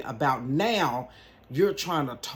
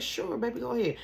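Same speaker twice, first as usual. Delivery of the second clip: aunt's voice; 8 semitones higher than usual. Retold or imitated. Aunt voiced in story.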